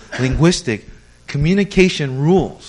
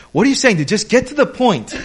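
higher, louder, laughing "get to the point"; mock impatient American; 8 semitones higher, 4 dB louder than usual. Pastor mimics a blunt American friend, laughing and impatient. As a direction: play the blunt buddy who wants it straight. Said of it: "that's a common saying".

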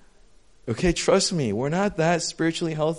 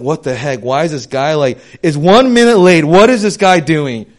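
louder, indignant mock rant at a latecomer; exasperated outburst; 2 semitones higher, 11 dB louder than usual. Pastor mocking his own impatience at a latecomer, loud exasperated outburst. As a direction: huff it out like you're fed up with someone late.

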